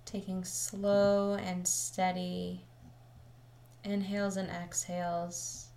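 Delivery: calm, measured meditation voice with long pauses; settled, even delivery, leaving space between phrases; guided meditation. Acted. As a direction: guide listeners gently into the breath, unhurried.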